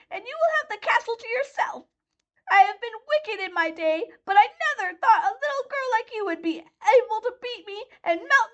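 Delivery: very high, wailing witch voice; dying witch. A narrator's reading. Melting witch's last words to Dorothy, very high and wailing. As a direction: lament your defeat.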